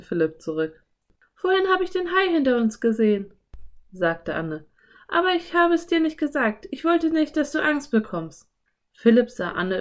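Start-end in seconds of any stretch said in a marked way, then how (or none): 1.3-3.3 put-on high, quick girl character voice; Anne. A narrator's reading. Anne confesses the shark sighting to Philipp, high quick voice. 5.1-8.5 put-on high girl character voice; Anne. A narrator's reading. Anne explains her silence to Philipp, high character voice.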